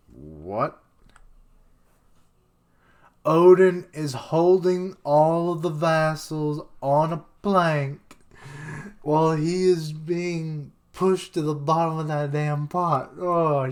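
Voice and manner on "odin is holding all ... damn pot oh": louder, higher, slower excitement; excited, loud. Real, not acted. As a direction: gasp it out, thrilled. Excited reaction to the scene.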